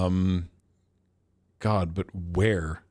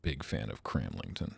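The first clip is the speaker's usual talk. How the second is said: quiet sarcasm; 12 semitones lower, 10 dB quieter than usual. Real, not acted. Sarcastic muttering at a map location.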